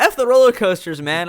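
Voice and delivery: loud, high dismissive outburst; mock outrage. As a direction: fire back with fake fury.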